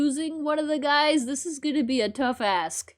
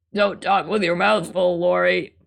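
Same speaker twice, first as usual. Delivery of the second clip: dad voice; 7 semitones lower, 4 dB louder than usual. Acted. Voicing the dad character.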